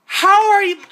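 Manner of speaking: loud, high, outraged question; exasperated shout. Real, not acted. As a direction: yell in disbelief and break off. Still ranting at the film.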